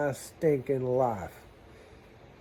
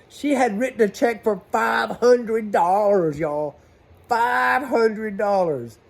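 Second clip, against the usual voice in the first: high, loud and slow, drawn out in outrage; indignant disbelief; 7 semitones higher, 7 dB louder, much slower than usual. Real, not acted. Telling the viewers about her check, in loud disbelief. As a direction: stretch out the five hundred like you can't believe it.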